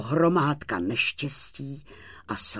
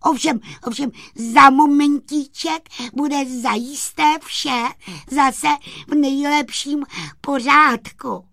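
high, stuttering, shivering sprite; frozen sprite stammering excuses in halting bursts; teeth chattering; 13 semitones higher, 7 dB louder than usual. Acted. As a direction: talk through chattering teeth, high-pitched. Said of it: "promrzlý, že drkotal zuby".

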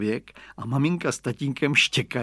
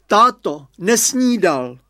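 higher, louder mother's shout, slow on the end; calling out; 7 semitones higher, 8 dB louder, slower than usual. A narrator's reading. Mother running out calling to father that the boy has not eaten, high and loud. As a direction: shout after the leaving cart. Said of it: "vyběhla maminka a volá".

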